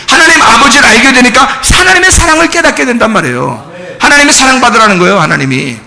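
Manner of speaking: high, forceful pulpit voice; impassioned preaching. Real, not acted. Preacher telling congregation why faith is given, fervent preaching. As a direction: preach it urgently, hammering each step.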